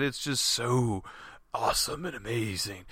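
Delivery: low, lightly mocking awe; mock the sales pitch in a low voice; sarcastic hype. Mimics Apple's hype with sarcasm.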